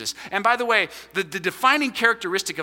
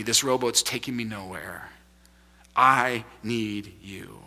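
humble prayer; 6 semitones lower than usual. Pretend. Modeled prayer to God.

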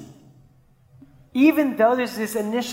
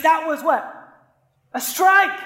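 high, loud indignant call of a strike; mock kid yelling; 6 semitones higher, 3 dB louder than usual. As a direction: play the outraged young pitcher. Kids arguing over a pitch.

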